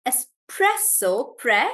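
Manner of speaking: espresso slowly, PRE punched; stress on PRE. Shown as a demonstration. Teacher drilling espresso slowly, stress on pre. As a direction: break espresso up and hit "pre". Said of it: "Stress on pre".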